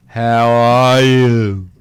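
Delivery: drawn out very slowly, slurring each word; slurred slow-motion. Shown as a demonstration. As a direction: talk like your own echo is slowing your brain down. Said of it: "You start to go".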